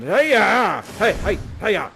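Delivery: loud hammed-up hey-ya; father disarming the prince with a stick; mock battle cry. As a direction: yell it like a karate strike.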